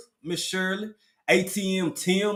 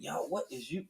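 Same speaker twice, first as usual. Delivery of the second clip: normal talking voice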